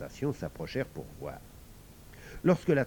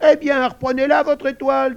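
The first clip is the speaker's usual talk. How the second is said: very high, loud squealing; shrill, vexed piglet voice; sulky piglet; 13 dB louder than usual. Acted. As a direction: squeal it petulantly like a little pig.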